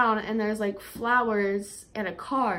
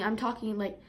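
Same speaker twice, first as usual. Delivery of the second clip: ordinary talk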